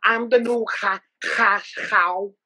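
hoarse cough through words. Retold or imitated. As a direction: cough up a lung while bragging. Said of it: "like coughing up a lung".

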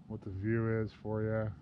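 ordinary talk, nothing marked

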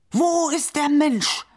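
God crying out at his hands for the lost man; shouted. A narrator's reading. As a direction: demand it, stretching every word. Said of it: "schrie er sie an".

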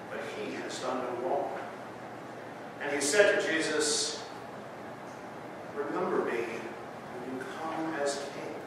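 hushed Good Friday reading; scripture reading. Acted. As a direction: read the Passion quietly, letting each line land. Reads the Passion scripture.

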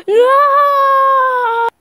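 high, loud scream of fright; a panicked scream of No; terrified scream. Acted. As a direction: shriek as if the creature just appeared. A creature suddenly appears.

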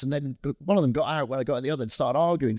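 normal talking voice

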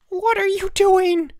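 very high, loud, drawn-out shriek. A narrator's reading. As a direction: shriek it in outrage, stretching the words. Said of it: "Elmer shrieked".

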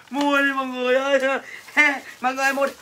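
hammed-up laugh-cry sales plea; mock sobbing through laughter; laughing and crying while selling. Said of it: "vừa cười vừa khóc vừa bán".

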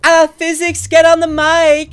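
man doing a woman's voice. Acted. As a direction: girlfriend voice calling her boyfriend. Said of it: "This is my voice".

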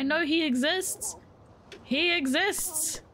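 high, slow drawn-out insistence; insisting. Real, not acted. Insisting the character is real, high slow drawn-out. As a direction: stretch it out, high and sure.

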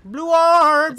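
very high, drawn-out shout; mock battle cry. Friend echoing the little blue orbs, huge high yell. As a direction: bellow it like an alarm.

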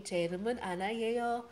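fast, smooth, all in one go; natural speed. Shown as a demonstration.

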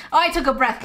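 ordinary talk

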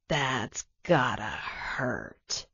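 low, soft, slow sneer; villain gloating softly over his victim; mocking villain. A narrator's reading. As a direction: savour his pain. Villain taunts the stabbed hero.

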